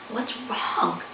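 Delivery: soft hushed question; whispered. Acted. Faith asks her brother, hushed. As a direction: pull back almost to a whisper. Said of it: "Faith whispered".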